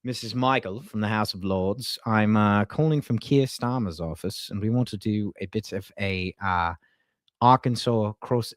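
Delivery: put-on British official; British accent. Streamer imagining a call from a Starmer aide, British accent. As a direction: try out a British prank-caller voice. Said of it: "like, a British guy".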